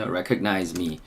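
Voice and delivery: usual speaking voice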